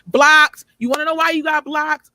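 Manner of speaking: loud exasperated snap; emphatic outburst. Real, not acted. Venting to callers who complained, high and loud.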